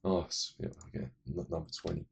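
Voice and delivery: ordinary talk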